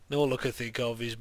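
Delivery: usual speaking voice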